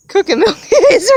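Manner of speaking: high, loud playful garble; silly mixed-up words. Retold or imitated. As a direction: chirp the mashed-up words with a laugh. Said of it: "say things like".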